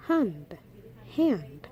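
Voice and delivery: and said as "hand", an extra H in front; added H. Shown as a demonstration. Teacher showing the patois and, with an added H. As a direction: put an H on the front of and. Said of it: "make it sound like".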